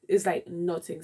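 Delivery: ordinary delivery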